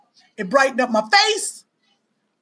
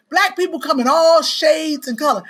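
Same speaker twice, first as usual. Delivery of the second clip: normal talking voice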